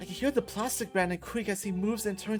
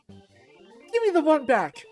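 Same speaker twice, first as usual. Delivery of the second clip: raised, higher voice, drawn out slowly; loud demand; 6 semitones higher, 8 dB louder, much slower than usual. Acted. Gamer demanding the controller back, loud. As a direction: call out the demand loudly.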